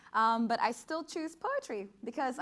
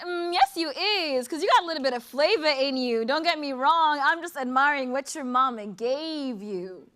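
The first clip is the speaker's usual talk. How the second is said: lightly voiced catcaller; 4 semitones higher, 9 dB louder than usual. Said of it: "He said".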